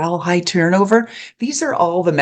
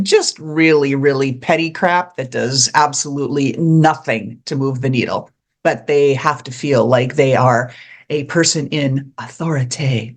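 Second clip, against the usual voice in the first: ordinary talk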